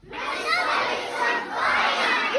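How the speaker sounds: slow, high drawn-out repeat; slow echo. Acted. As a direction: stretch the cry out.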